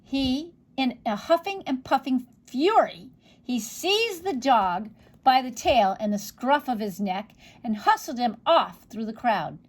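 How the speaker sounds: usual speaking voice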